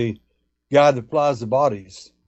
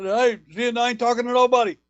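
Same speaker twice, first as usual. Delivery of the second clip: mock scream; 10 semitones higher than usual. Guy refusing to talk to anyone, mock scream. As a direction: retell a man's yelled refusal, pitched way up.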